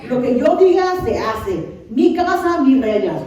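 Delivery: loud, high, emphatic decree; self-important king. Acted. Preacher voicing David as absolute ruler, self-important king. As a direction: lay down the law, mock bossy. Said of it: "pues, él era el rey".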